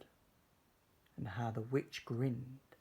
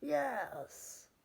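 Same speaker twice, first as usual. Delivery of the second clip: very high witch's cry; witch crying yes; 13 semitones higher than usual. Acted. Witch welcoming the dog aboard, very high. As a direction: squeak out a delighted yes. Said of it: "cried the witch".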